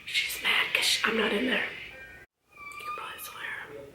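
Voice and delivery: soft whispered aside to viewers. Real, not acted. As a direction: drop to a breathy whisper mid-chat. Baby walks in, hushed aside.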